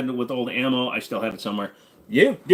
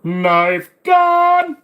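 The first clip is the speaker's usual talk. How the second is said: much higher, much louder gleeful shout; excited shout; 10 dB louder than usual. Pretend. Streamer shouts gleefully about a bayonet pistol. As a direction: yell it like a kid who found a toy.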